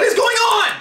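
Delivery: loud, high, disbelieving shout; real shout. Real, not acted. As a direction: yell it out in shock. Friend spending her money live.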